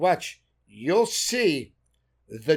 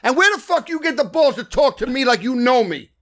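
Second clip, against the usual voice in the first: in-character fury; 8 semitones higher, 8 dB louder than usual. Retold or imitated. Actor snapping at the director in character, furious yell. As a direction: stay in character and threaten him. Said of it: "I go".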